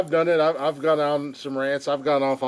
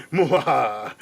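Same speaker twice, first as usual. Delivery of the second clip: evil villain laugh; 4 dB louder, slower than usual. Retold or imitated. Villain laugh after taunting quote.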